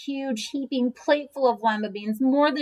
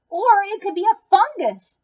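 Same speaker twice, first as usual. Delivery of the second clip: a higher, louder eager guess; quick eager suggestion; 11 semitones higher, 7 dB louder than usual. A narrator's reading. Doctor jumping in with a new theory, higher and louder. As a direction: blurt out the next idea. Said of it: "added Doctor Gord instantly".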